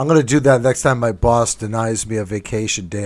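normal talking voice

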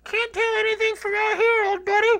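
very high, loud tiny-man voice; Ant-Man. Acted. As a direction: squeak it like a shrunken hero. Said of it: "It's Ant-Man".

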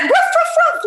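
high, loud playful barking with laughter; giggly mock dog barks. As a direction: bark like a silly dog. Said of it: "like a dog".

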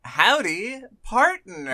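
high, drawn-out hammed-up howdy partner; mock cowboy howdy. Cowboy host greeting the listener at the saloon, hammed-up high howdy.

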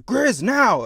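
sudden high shouted call; shouting. Retold or imitated. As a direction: yell the signal. Kid calling for door.

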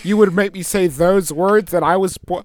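high, loud hammed-up indignation; mock outrage. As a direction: play the offended guest, over the top. Mock indignant guest rant.

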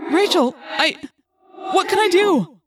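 loud, high, helpless plea; desperate. A narrator's reading. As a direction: cry out helplessly to a trapped friend. Helpless friend hears they are trapped.

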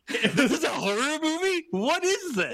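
high, laughing incredulous question; cracking up while asking; giggling in disbelief. Real, not acted. Laughing disbelief at absurd scene.

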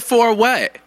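high, challenging mock telling-off; retold teacher's scolding. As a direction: imitate a teacher demanding an explanation. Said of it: "You said a teacher yelled at you".

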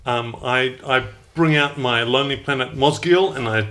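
ordinary talk, nothing marked

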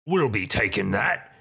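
lightly put-on hobgoblin thug voice; hobgoblin leader. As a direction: claim the prize as a hobgoblin boss. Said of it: "The hobgoblin".

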